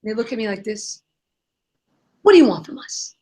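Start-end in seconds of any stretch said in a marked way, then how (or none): 2.2-3.1 imitating suspicion, a little louder; mock a distrustful demand, falling at the end; wary challenge. Street cleaners to the woman offering drinks, wary challenge.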